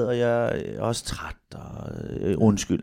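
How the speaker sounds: soft, sinking, contrite voice; weary apology. Retold or imitated. Parent to child after anger, weary and quiet. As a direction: trail down into a tired sorry.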